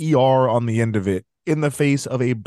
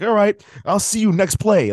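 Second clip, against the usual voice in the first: higher, lightly acted letting-it-go; mock easygoing acceptance; 6 semitones higher than usual. Imagined player letting a slur go, mock easygoing. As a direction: pretend to brush it off. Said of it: "you don't let this go".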